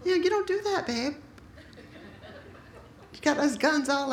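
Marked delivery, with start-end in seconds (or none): 0.0-1.1 a high, mocking lilt on don't; mock protective dad. Pastor imitating Scott warning the date, mock high sweetness. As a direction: tease it up high like a doting dad. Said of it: "he's like".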